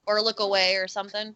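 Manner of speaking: ordinary talk, nothing marked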